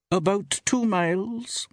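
high, slow woman's voice; shopkeeper woman. A narrator's reading. Woman shopkeeper gives a traveller the distance, high and slow. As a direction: answer slowly in a high female voice.

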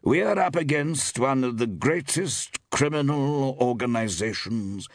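"greatest criminal organizations" slow, high and weighty; elaborate emphasis. A narrator's reading. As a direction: build up "greatest criminal organizations" portentously, as a leg-pull. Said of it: "began Forbes with elaborate emphasis".

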